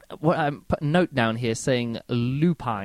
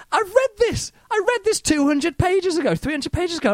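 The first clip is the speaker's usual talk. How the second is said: much higher, louder, shrieking in disbelief; exasperated outburst; 3 dB louder than usual. Retold or imitated. Reader protesting a repeated scene, exasperated high shriek. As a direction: throw up your hands at the repeated passage. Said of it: "I'm like".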